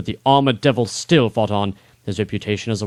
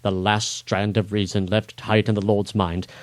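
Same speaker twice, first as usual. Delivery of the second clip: ordinary delivery, nothing marked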